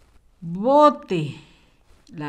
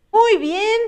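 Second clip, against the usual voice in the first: excited praise; 10 semitones higher than usual. Real, not acted. Teacher cheering a child's good answer, pitched very high. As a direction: lift the pitch way up to reward the answer.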